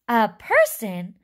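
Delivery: loud, drawn-out question; astonished cry. A narrator's reading. Wonka reacts to sending people, loud astonished cry. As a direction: stretch it out in loud disbelief. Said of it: "cried Mister Wonka".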